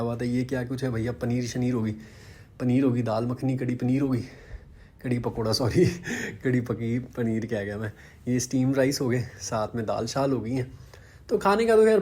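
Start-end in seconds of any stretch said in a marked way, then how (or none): 5.9-6.4 short, soft chuckle; brief quiet laugh at oneself. Real, not acted. Vlogger amused by his own dish-name slip, soft chuckle.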